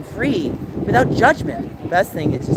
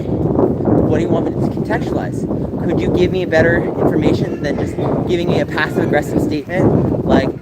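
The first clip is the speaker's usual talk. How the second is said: ordinary talk, nothing marked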